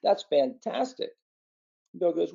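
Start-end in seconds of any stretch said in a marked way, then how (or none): 0.0-1.1 high, gushing neighbor voice, slowing on the praise; excited neighbor. Acted. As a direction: rave like a thrilled country neighbor. Neighbor raves about wild mares.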